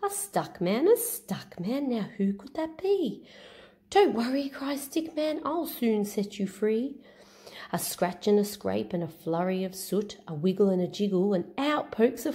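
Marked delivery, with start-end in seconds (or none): 0.0-7.0 high, sing-song little stick hero; Stick Man voice. Acted. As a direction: chant the refrain brightly, stretched out.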